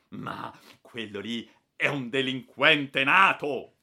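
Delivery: slow, loud indignant outburst. Acted. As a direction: spit out the contempt. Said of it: "esclamò al colmo dello sdegno". (sdegnato, lento e forte)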